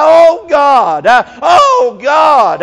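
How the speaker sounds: loud, slow, wailing oh God; preacher reliving his weeping cry. Retold or imitated. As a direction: let the grief break out loud. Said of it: "I began to cry".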